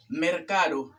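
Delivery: "mercado" with a clear R before the c; "mercado" as mer-CA-do, tapped R; correct R. Shown as a demonstration. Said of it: "con R".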